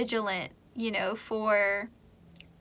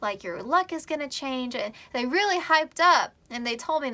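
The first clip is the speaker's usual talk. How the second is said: ordinary delivery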